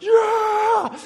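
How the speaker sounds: high, loud victory shout; triumphant scream of joy; excited yell. Retold or imitated. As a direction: yell it like a kid who just flattened someone. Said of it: "I'm like".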